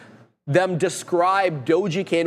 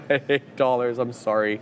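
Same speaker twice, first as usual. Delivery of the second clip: laughing; 5 semitones lower than usual. Real, not acted. Laughs at own slip.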